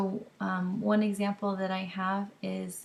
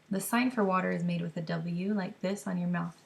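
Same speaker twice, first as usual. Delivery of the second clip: ordinary delivery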